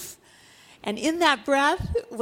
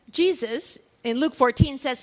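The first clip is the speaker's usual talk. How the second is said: ordinary talk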